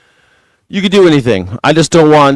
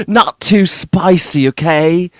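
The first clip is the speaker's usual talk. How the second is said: slow, drawn-out mock British accent; fussy Englishman, hammed up in British English; Steve Hilton; 3 semitones higher than usual. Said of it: "I love going to London".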